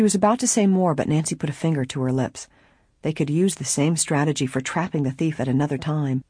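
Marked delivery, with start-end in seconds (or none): none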